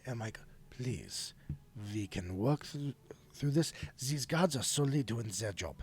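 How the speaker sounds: soft, low and soothing; calming voice. Acted. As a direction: settle an upset man down gently. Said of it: "as I, I calm him down".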